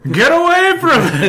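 high, loud mock scream; hammed-up repeat shriek. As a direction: shriek the warning in character. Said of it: "And I scream".

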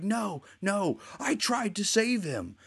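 high, slow pleading denial; mock desperate protest.

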